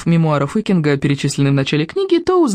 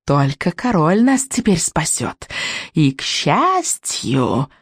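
very slow, drawn-out witchy purr; purring witch; much slower than usual. A narrator's reading. Witch offering the Vikings hope, purring. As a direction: purr it out, savouring each word. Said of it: "промурлыкала ведьма".

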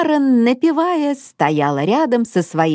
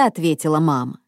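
ordinary delivery, nothing marked

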